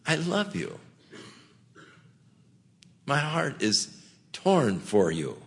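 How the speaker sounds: soft, heartfelt reassurance with long pauses; tender appeal. Acted. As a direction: speak softly, as an anguished pastor to his flock. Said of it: "so you could hear my tone of voice".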